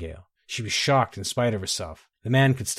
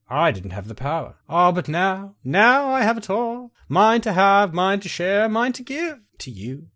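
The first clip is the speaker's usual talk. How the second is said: higher, louder, swelling triumph; exultant Nightingale; 9 semitones higher, 4 dB louder than usual. Acted. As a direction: crow over newfound power to win her. Voices the Nightingale boasting of power.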